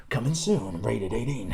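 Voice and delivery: low movie-trailer announcer impression; trailer voiceover guy. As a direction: intone it like an epic film trailer.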